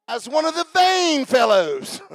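high-pitched scornful mockery; mock him in a high sarcastic voice. Wife scorning the king as vain, sarcastic. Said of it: "I think she said it like this".

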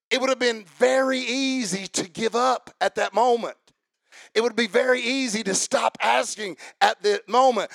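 high, loud, driving sermon delivery; impassioned preacher building in intensity; full-force preaching. Real, not acted. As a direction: preach it hard to the congregation.

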